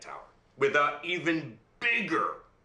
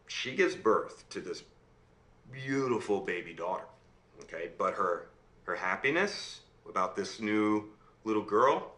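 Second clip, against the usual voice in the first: usual speaking voice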